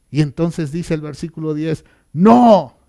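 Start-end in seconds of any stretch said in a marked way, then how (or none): 2.1-2.8 loud, higher burst of refusal; emphatic refusal. Retold or imitated. King refuses his men's offer, loud and firm. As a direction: cut them off with a forceful no. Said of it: "dijo el rey".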